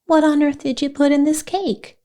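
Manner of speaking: high, indignant demand; exclaiming. A narrator's reading. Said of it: "she exclaimed".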